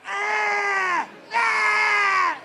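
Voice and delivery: high, loud drawn-out scream; long high-pitched war cry; child's aggressive yell. Retold or imitated. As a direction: imitate a furious kid screaming. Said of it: "Like his eight-year-old just going".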